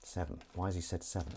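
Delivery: low, soft aside; quiet puzzled aside. Acted. Imagined viewer wondering about the seven, quiet puzzled aside. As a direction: drop to a hushed murmur.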